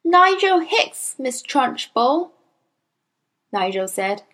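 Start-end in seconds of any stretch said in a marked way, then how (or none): 0.0-2.3 put-on high, slow careful child's answer; small boy. A narrator's reading. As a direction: say it like a good little pupil.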